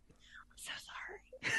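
whispered, slow apology; hushed sheepish sorry. Real, not acted. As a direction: breathe it out softly. Apologizing for interrupting.

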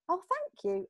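high-pitched, gracious acceptance; smiling thanks. Shown as a demonstration. Coach showing how to accept a compliment, smiling thanks. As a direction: take the compliment with a smile in the voice. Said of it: "and smile and actually take it in".

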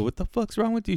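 high-pitched exasperated question; retold exasperation. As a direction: act out baffled impatience. Retold baffled reply to caller.

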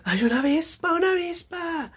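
very high falsetto squeal; high-pitched comic alarm; mock panicked cry. Acting out friend fleeing a wasp.

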